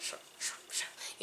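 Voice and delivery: whispered, urgent shushing from fellow judges; hushed shut up. Retold or imitated. Fellow judges hushing her in an audition, whispered. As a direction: hiss it under your breath so nobody hears. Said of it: "And they were like".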